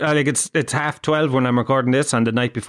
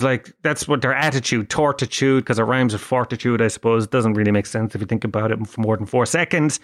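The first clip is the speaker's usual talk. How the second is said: ordinary talk, nothing marked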